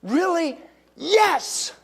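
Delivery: high, eager gasp of interest; mock gossip thrill, high and loud; gossipy surprise. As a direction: jump way up in pitch like juicy news. Acted gossip exchange.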